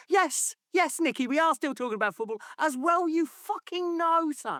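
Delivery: a reporter mocking Barmby at the press conference, in a high sneer; mock journalist. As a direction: sneer back at him like a fed-up journalist. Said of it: "it's like".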